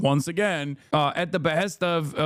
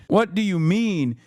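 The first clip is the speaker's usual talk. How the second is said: ordinary delivery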